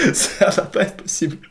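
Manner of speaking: breathless high laughing voice; wheezing with laughter. Real, not acted. Friend realising their lie has been exposed, laughing breathlessly. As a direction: squeeze the words out through laughter.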